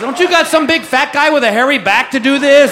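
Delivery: loud, high-pitched comic pleading; mock panic. Husband asking for another masseuse, mock panic.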